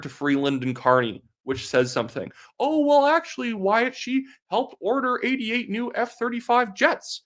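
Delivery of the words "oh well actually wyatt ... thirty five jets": mocking whiny rebuttal. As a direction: parody the know-it-all comeback, pitched way up. Mocking a Liberal defender's rebuttal.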